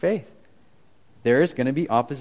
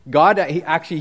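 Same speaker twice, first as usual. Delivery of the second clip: ordinary talk, nothing marked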